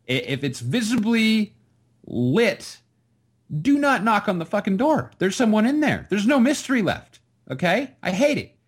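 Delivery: high, emphatic angry rant; exasperated rant. Real, not acted. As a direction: lay down the law about knocking. Bathroom-knock rant.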